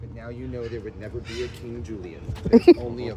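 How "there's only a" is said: laughing. Real, not acted. Joking about the lemur queen.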